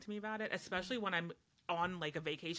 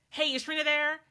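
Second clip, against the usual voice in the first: put-on high, loud breezy teen; casual caller; 11 semitones higher, 10 dB louder than usual. Friend calling the house phone, breezy and loud. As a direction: chirp it like a kid on the phone. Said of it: "my brother's friend would be like".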